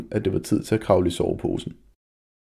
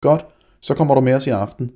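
fast, louder, higher taunt; mocking; 5 semitones higher, 6 dB louder, faster than usual. A narrator's reading. Bully taunting Jonas to come along, mocking. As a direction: sneer it quickly at him. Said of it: "sagde Malte hånligt".

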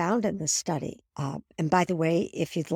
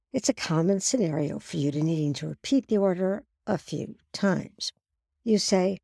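ordinary delivery

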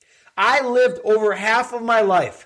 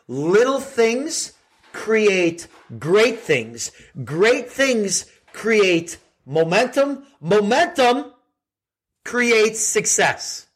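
very slow, spaced words; slow motivational maxim; 3 semitones higher, much slower than usual. Acted. Host gives listeners a slow, weighty motivational maxim. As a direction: let each phrase land slowly.

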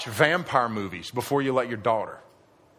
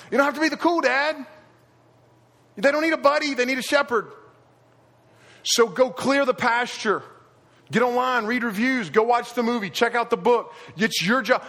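full-force preaching; 9 semitones higher than usual. Acted. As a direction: preach it hard to the fathers. Sermon climax, preaching hard.

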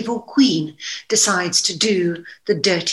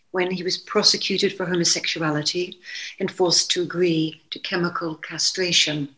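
usual speaking voice

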